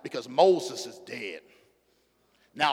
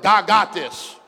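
louder repeat; 5 semitones higher, 10 dB louder, much slower than usual. Shown as a demonstration. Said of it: "a third".

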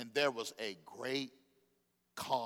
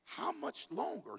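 exasperated Jesus; 9 semitones higher, 6 dB quieter, slower than usual. Acted. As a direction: sigh it out, fed up. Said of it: "he got aggressive".

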